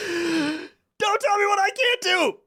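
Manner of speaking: high, slow hammed-up yell; imitating an angry shout, pitched high; mock shout. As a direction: stretch it out as a high mock outburst. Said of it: "he shouts".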